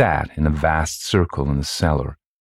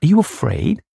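high-pitched worried question; concerned asking; 3 dB louder than usual. A narrator's reading. Louise asks daughter in the dark, concerned. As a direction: ask anxiously in a mother's raised voice. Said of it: "asked Louise".

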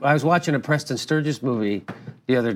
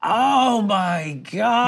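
higher, very drawn-out disbelief; astonished; 6 semitones higher, much slower than usual. Real, not acted. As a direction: stretch each word out in amazement. Astonished that Quinn was Mexican.

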